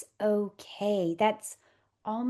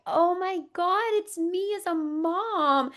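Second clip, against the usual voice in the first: higher, awed "Oh my God"; awed surprise; 9 semitones higher than usual. Retold or imitated. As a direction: marvel at seeing yourself. Seeing herself as a mom.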